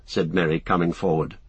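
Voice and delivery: plain reading voice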